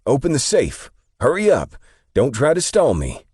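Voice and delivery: high, loud, urgent commands; yelling. A narrator's reading. Young robber hurrying the clerk, yelling. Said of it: "heard the young man yell again".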